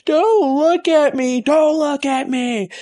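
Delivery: high, slow, eerie possessed voice; possessed Nikki. Retold or imitated. Nikki warning Bear off, high, slow and eerie. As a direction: drag it out high like a possessed girl.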